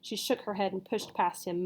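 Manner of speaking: ordinary delivery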